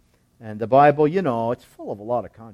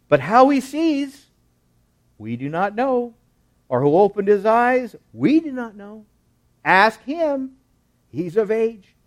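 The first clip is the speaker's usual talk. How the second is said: high, emphatic disclaiming; cautious parents; 8 semitones higher, 7 dB louder than usual. Acted. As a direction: disown the question, keen to stay out of trouble. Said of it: "They are not wanting to get in trouble".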